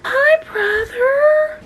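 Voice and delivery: high, slow cooing; baby talk to pet. Acted. Greeting the brother cat in baby talk. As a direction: stretch it out sweetly.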